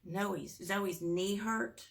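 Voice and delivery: ordinary delivery, nothing marked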